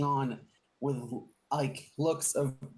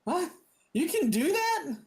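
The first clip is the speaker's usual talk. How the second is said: much higher, astonished squeak; amazed; 4 dB louder than usual. Acted. Captive learns the witch can fix him, high astonished. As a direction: gasp it out in disbelief.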